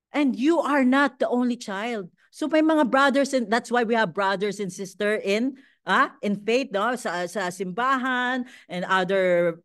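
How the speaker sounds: usual speaking voice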